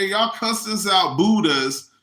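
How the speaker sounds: ordinary talk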